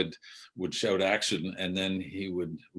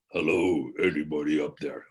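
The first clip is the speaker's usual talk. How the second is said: imitating a deep voice calling up the stairs; a lightly deepened voice calls out from below; 4 semitones lower than usual. A stranger calling up the stairs to the loft, in a mock deep voice. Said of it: "even deeper than my voice".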